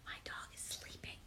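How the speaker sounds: soft whispered aside. Real, not acted. Aside to viewers while the dog sleeps nearby, whispering. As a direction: whisper so the dog stays asleep.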